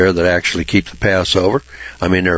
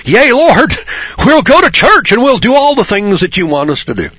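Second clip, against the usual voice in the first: much higher, loud eager pledge; mocking religious people; 13 semitones higher, 5 dB louder than usual. Preacher mocks religious people promising the Lord. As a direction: gush a pious promise. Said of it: "and they say".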